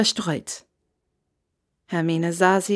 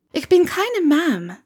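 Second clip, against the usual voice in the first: higher, slower Hermione, stress on bin; Hermione correcting, firm; 8 semitones higher than usual. A narrator's reading. Hermione corrects the innkeeper, slow and pointed. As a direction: put him right, slow and pointed.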